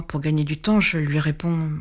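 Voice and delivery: plain reading voice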